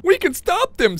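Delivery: high mocking singsong of a teammate's boast; mimicking a teammate's boast to ridicule him. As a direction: parrot him in a silly high voice. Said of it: "says Times".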